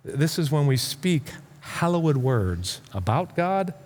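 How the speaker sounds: ordinary delivery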